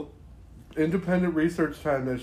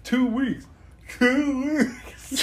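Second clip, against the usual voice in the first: high, laughing mock robotic repeat; hammed-up movie impression through laughter; Total Recall disguise; 11 semitones higher, much slower than usual. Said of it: "where Earl's like".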